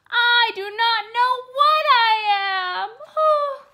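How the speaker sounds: high, loud wailing sob; upset at not knowing what he is; breaking into tears; crying. Acted. Walt distressed not knowing himself.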